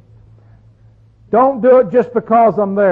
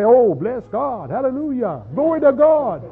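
lightly hammed-up holy talk with a laugh; mock piety from a hypocrite who curses all week; mock pious churchgoer; 3 semitones higher than usual. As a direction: parody a Sunday-only saint. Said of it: "on Sunday I come in and say".

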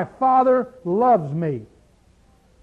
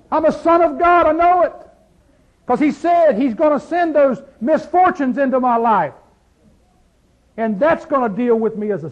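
loud, high, ringing preacher's shout; full-force preaching; 5 semitones higher, 5 dB louder than usual. Acted. Pastor rousing the congregation, loud and high. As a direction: lift the whole room with it. Preaching the son-of-God resume.

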